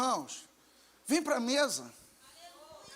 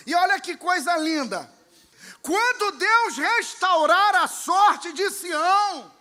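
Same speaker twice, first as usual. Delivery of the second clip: loud, fervent sermon proclamation; preaching at full force; 4 semitones higher, 9 dB louder than usual. Acted. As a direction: shout the promise over the church. (pregação fervorosa e alta)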